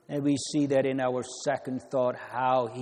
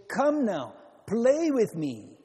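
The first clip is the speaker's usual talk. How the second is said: higher, lilting call to play; playful invitation; 6 semitones higher than usual. Acted. Preacher voicing someone asking a child to play, coaxing. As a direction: coax a child to join in, pitched up.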